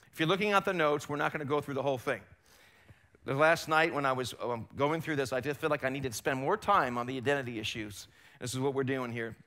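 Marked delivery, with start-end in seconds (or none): none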